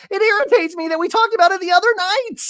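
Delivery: high, loud upset rant; Shannon flipping out. Retold or imitated. Shannon flipping out at Tamara, high loud rant. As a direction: blow up in a high voice. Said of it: "Shannon's flipping out".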